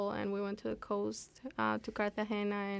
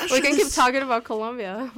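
louder, through laughter; laughing; 4 semitones higher, 8 dB louder than usual. Real, not acted. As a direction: tease about changing topic. Laughing at topic change.

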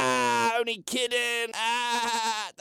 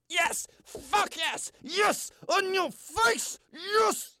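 excited cheering; 11 semitones higher, slower than usual. Acted. Cheering at bingo win.

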